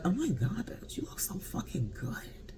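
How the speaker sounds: breathless gasp into a whisper; awed whispered compliment. Retold or imitated. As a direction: whisper it like she stole your breath. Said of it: "I was just like".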